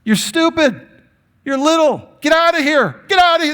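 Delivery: put-on high, sneering voice shooing the kids away; scornful disciples. Disciples shooing the children away, in a high scornful voice. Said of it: "the disciples were looking at these kids like".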